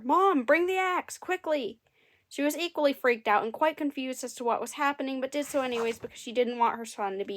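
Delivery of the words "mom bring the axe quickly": higher, slow urgent call to mother; boy calling his mother in a panic. A narrator's reading. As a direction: shout up for help. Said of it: "he called out to his mother".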